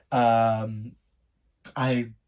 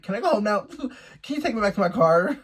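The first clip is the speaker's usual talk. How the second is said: high, loud, giggly pleading; nervous plea; 7 semitones higher, 5 dB louder, faster than usual. Retold or imitated. Asking date for a ride back, high nervous plea. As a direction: ask high-pitched, desperate to get out.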